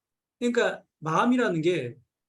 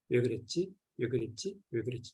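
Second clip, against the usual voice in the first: softer, lower, fading each time; quiet self questioning; 4 semitones lower, 7 dB quieter than usual. Shown as a demonstration. Teacher showing inner self questioning, growing softer. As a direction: ask yourself under your breath, trailing away. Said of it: "쪼개서 가다 보면".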